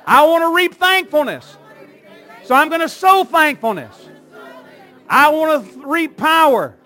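loud, high declarations with long pauses between phrases; rousing, shouted revival-style call; full-force preaching. Real, not acted. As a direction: proclaim each phrase for a congregation to echo.

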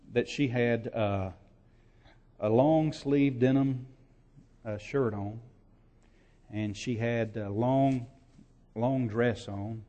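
usual speaking voice

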